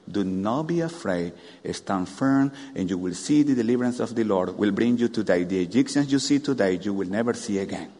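low soft reassuring Moses; steady them gently; calm Moses. Acted. Moses calming the frightened people, low and soft. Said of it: "Then Moses answered the people".

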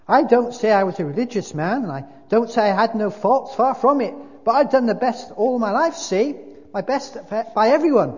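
loud, high-pitched protest; self-righteous big ghost, indignant. A narrator's reading. Ghost defending his life to the spirit, loudly indignant. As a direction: insist on your good record, hard and loud.

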